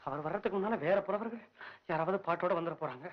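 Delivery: ordinary delivery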